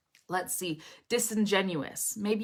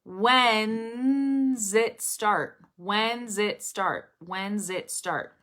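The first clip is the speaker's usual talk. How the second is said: "When's it start" very slowly, holding the n into zit; slow teaching demo of when / zit / start; three words; 2 semitones higher, much slower than usual. Shown as a demonstration. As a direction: stretch "When's it start", then ease off with each repeat. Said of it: "imagine this being three words".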